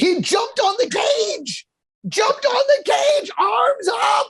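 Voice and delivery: can't believe what he saw; excited disbelief. Real, not acted. Scene: podcaster describing fighter jumping on cage, incredulous. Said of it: "animated retelling".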